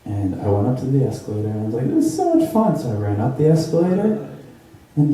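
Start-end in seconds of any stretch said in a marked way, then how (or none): 1.9-2.8 put-on high, delighted child's squeal; gleeful kid. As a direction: bubble over like a thrilled seven-year-old. Kid thrilled on escalator.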